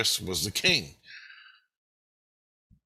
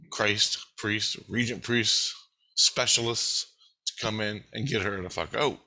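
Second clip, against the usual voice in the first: ordinary talk, nothing marked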